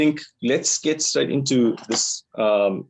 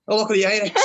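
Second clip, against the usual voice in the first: put-on quick playful Irish accent, higher; 9 semitones higher, much faster than usual. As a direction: try a light Irish accent, laughing. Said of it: "Not as well as your Irish accent".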